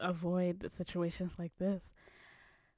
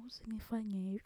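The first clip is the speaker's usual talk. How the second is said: Swahili begging impression; 8 dB quieter, faster than usual. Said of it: "I'll try".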